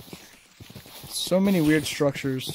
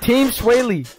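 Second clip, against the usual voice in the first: high, loud team cheer; excited cheer; 8 semitones higher, 6 dB louder, slower than usual. Real, not acted. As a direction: shout the team name proudly.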